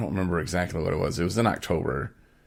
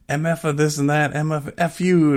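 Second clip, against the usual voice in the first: mock yell, much higher, cursing; angry yelling; 10 semitones higher than usual. Tyrone cursing at the phone lady, imitated yell. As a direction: imitate a furious caller cursing. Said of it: "yelling at this".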